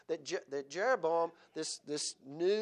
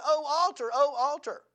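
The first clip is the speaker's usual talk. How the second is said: loud, raised prophetic cry; 8 semitones higher, 6 dB louder than usual. Retold or imitated. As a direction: cry out the lament to the altar. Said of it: "when you cry against an altar".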